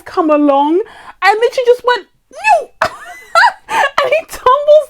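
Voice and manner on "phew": high-pitched playful whoosh sound; mock whoosh. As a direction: blurt it like a cartoon shove. Bad guys knock him down.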